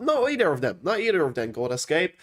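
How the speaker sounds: usual speaking voice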